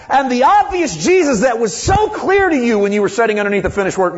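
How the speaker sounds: high-pitched, full-force sermon cry; worked-up preacher hammering each point; impassioned preaching. Real, not acted. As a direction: preach it at the top of your range, punching key words.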